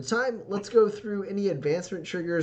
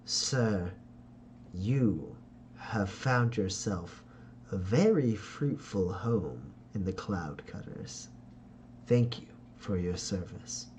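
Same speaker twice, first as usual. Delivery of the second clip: lower, slow, oily noble gratitude; Ferdinand's smooth voice; 5 semitones lower, 5 dB quieter, slower than usual. Acted. Father Ferdinand thanks Jack for his service, low slow silky voice. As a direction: thank him like a silky schemer.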